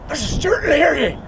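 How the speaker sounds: high, loud hissed warning; urgent warning. Retold or imitated. Boy warning his pal the neighbour will hear, high and urgent. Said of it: "the next thing I heard was".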